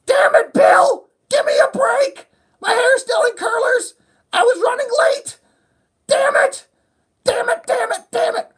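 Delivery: very high, loud, screeching mock meltdown; hammed-up shrieking, near-crying housewife; harried mother yelling at her boss about curlers; frazzled mother. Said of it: "And she's like".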